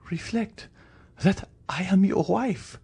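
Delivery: high, frightened appeal; desperate high-pitched reminder; pleading wife. Acted. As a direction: implore a husband in a high, thin cry.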